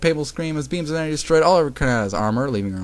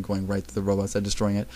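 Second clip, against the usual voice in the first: usual speaking voice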